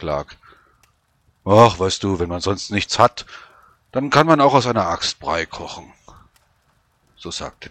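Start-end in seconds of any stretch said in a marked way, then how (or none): 1.4-5.9 soldier voice. Acted. Soldier explains his trick.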